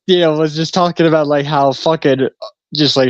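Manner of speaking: normal talking voice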